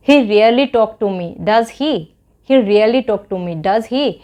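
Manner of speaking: slow, clear teaching read with a falling tag; slow model sentence. Shown as a demonstration. Teacher showing students how to say the English tag, slow and clear. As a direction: spell it out slowly, twice over. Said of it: "मैंने बोला कि".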